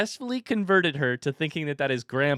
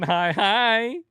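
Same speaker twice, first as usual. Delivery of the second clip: drawn-out hi rising high and loud; delighted parent greeting; 8 semitones higher, 5 dB louder than usual. Real, not acted. Echoing toddler's greeting to her.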